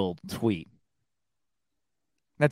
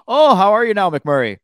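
put-on Letterkenny accent; 8 semitones higher, 7 dB louder than usual. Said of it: "this show Letterkenny".

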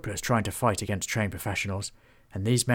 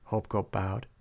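ordinary delivery, nothing marked

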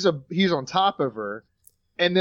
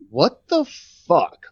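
stunned disbelief; much slower than usual. Retold or imitated. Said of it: "And he's like".

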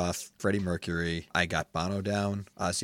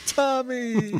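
high, delighted call of his name; excited greeting; 4 dB louder than usual. Retold or imitated. Bandmates welcoming [name] at the door, excited call. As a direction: hail him like an old friend.

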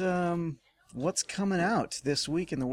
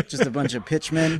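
usual speaking voice

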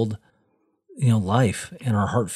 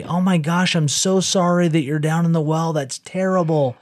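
mock sympathy; 6 semitones higher than usual. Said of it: "you just yell down to 'em and say".

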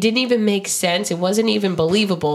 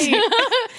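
high giggle. Real, not acted. Laughing at own impression.

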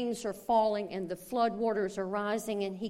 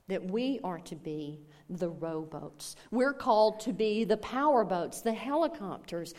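ordinary talk

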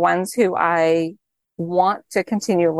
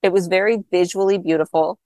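normal talking voice

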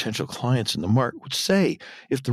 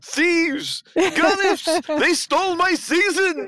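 very high-pitched mock outrage; indignant shouting; much slower than usual. Bullets finds the garment bag empty, mock indignant shouting. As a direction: imitate an indignant cry of robbery, pitched way up. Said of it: "he indignantly begins shouting".

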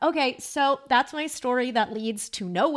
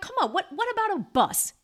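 much higher, pleading disbelief; exasperated disbelief; 11 semitones higher than usual. Retold or imitated. Telling the couple about the bus, in high exasperated disbelief. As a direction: throw up your hands at the idea of no bus.

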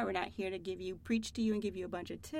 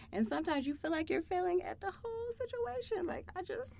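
high-pitched flustered venting; frazzled mom; 7 semitones higher than usual. Retold or imitated. Mom at wit's end, voice pitched high. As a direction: act out a mom losing it, pitched up.

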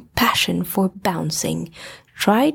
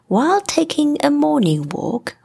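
usual speaking voice

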